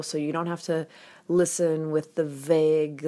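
normal talking voice

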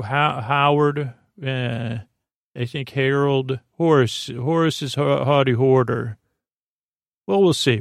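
normal talking voice

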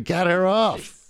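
retold scream. Co-host retells player screaming from the pitch, mock scream. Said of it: "Katie McCabe was screaming".

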